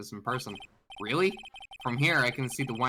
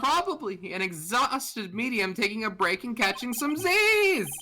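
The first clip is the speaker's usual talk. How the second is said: loud, high comic yelling; mock-oblivious shouting; 7 dB louder than usual. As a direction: shout it as if nobody were sleeping. Loudly reading beside sleeping medium, as joke.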